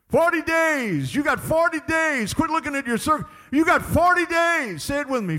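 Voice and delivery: high, loud pulpit proclamation; full-force preaching. Real, not acted. Preacher telling the congregation their time is short, loud urgent preaching. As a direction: hammer the deadline into the crowd.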